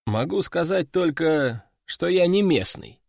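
usual speaking voice

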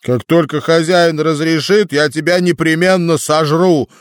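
высокий голос дракона с угрозой; пригрозить голосом дракона. A narrator's reading. Дракон угрожает крысу. (угрожающий дракон)

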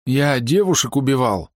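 высокий, дрожащий от страха голос; кается в ужасе, выше обычного; испуганно. A narrator's reading. (испуганный солдат)

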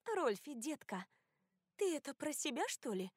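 high, sugary and mocking; dangerously sweet. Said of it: "изумилась Кори опасно сладеньким тоном". (опасно сладеньким тоном)

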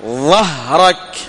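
very slow ظهرك, tongue pushed out for the ظ. Shown as a demonstration. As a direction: draw out the ظ of ظهرك. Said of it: "أخرج اللسان".

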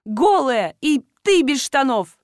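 loud, high accusation with a touch of tears; mock tearful outburst. A narrator's reading. Wife accusing the stranger in the barn, mildly tearful outburst. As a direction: accuse loudly through half-acted tears. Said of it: "зарыдала и, давясь слезами, договорила".

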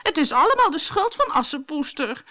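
high-pitched whiny blame; whining. A narrator's reading. Bertha blaming Cinderella after the ball, whining. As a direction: whine and blame someone else. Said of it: "zeurde Bertha".

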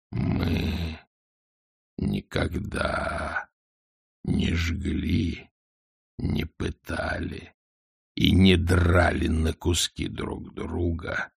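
slow, low, hushed dragon; scornful, slow and low; contemptuous dragon. A narrator's reading.

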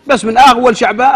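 ordinary delivery

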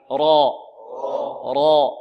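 را loud, thick and held; emphatic را with a back vowel; heavy raa. Shown as a demonstration. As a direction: drill a dark, full را for repetition. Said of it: "مفخمة".

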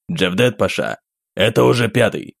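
tense worried report; strained, urgent voice. Acted. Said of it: "Его голос звучал напряжённо". (напряжённый голос)

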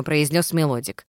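ordinary delivery

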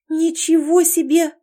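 high, loud, drawn-out exclamation; impressed wow, stretched out; amazed. Acted. Girl marvels at what she sensed in the heroine, amazed. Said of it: "она присвистнула".

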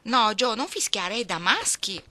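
loud, high scolding. A narrator's reading. Older sister scolding Jo for whistling like a boy, loud and high.

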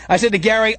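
usual speaking voice

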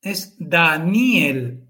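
es Daniel, slow, with the i and e split; slow syllable by syllable. Shown as a demonstration. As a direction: say Daniel slowly, Da-ni-el. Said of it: "se separa". (Daniel despacio, separando la i y la e)

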